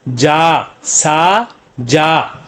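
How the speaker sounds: "सा जा" very slow, long separate syllables, said twice. Shown as a demonstration. Teacher showing what the letters make, "सा जा" slowly sounded out. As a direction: hold "सा", pause, then long "जा". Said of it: "तो क्या बन गया".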